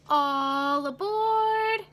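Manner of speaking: sing-song conductor calling all aboard; conductor's call. Acted. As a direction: call it out down the platform like a train conductor. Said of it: "the conductor cried out".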